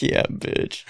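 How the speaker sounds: whispered, soft gloating aside; smug whisper. Retold or imitated. After the astrology trick worked, a smug whispered gloat. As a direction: whisper a smug little victory. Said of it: "And I'm like".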